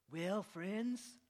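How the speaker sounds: a light folksy presidential hint; Reagan impression. Pastor teases the congregation with a light Reagan voice. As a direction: ease into it like Reagan. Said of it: "do my best impression of a U S president".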